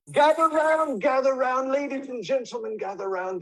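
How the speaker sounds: high, booming street crier call; Shakespearean street performer. Acted. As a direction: call the crowd in like a fairground barker. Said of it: "a Shakespearean street performer".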